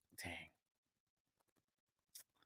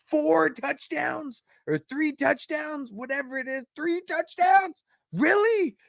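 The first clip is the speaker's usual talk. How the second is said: very high, slow incredulous voice; mock disbelief; 4 dB louder than usual. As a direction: stretch it out in fake shock. Mocking the player's real stats.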